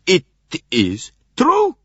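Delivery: high, loud sudden realization; excited cry. A narrator's reading. Doctor exclaiming to Poirot, high and excited. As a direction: burst out with the discovery. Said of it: "cried Constantine excitedly".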